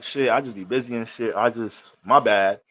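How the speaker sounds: low, offhand brush-off; nonchalant shrug. Retold or imitated. Boyfriend brushing off girlfriend's complaint, low and nonchalant. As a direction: toss it away like it's nothing.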